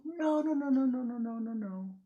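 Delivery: loud, high repeated no; emphatic denial. A narrator's reading. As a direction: hammer the no's home. Narrator stresses no hugs.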